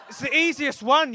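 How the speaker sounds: ordinary talk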